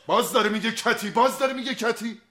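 agitated pleading. Acted. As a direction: talk fast and defensive, pitch up. Agitated, defensive explanation. (با هیجان و دستپاچگی)

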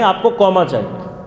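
ordinary talk

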